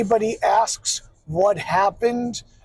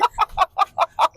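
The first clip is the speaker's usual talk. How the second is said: amused laugh; 8 semitones higher than usual. Real, not acted. Laughing at own goat voice.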